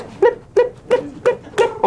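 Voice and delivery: very high, quick chirpy blip-blip-blip. Shown as a demonstration. Teacher showing high frequency to class, squeaky blips. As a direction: squeak it out like rapid high pulses. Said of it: "higher frequency or lower?".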